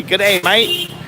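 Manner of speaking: light imitation of an Australian g'day; Australian greeting. Tourist retelling a local's Australian greeting, lightly mimicked. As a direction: mimic the Aussie accent lightly, rising at the end. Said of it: "that guy just told me".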